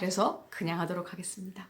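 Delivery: fast, soft whispered aside. Real, not acted. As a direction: murmur it under your breath, quickly. Quiet aside to viewers.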